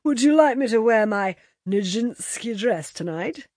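calling out. A narrator's reading. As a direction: call it out theatrically. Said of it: "called Bobby".

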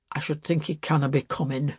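put-on mildly uneasy old man; understated unease. A narrator's reading. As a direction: say it with a touch of worry. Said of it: "said the father uneasily".

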